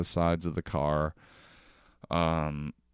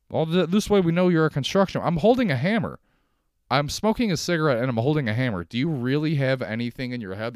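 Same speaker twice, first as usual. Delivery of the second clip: higher, louder ranting over the vest rule; 6 semitones higher, 6 dB louder than usual. Acted. Comic griping at bosses about vests, loud exasperated rant. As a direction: build the outrage and let it ring out.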